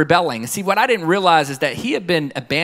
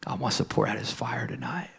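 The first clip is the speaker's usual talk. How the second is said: very soft, breath-only close; hushed whisper; 4 semitones lower, 13 dB quieter than usual. Real, not acted. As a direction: drop to a whisper to end the sermon.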